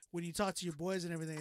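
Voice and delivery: normal talking voice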